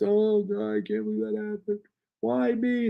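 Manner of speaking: high, wailing "why me" voice, laughing; hammed-up anguished wail; mock despair. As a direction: play the laughing-crying emoji in despair. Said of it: "The laughing, crying emoji is the".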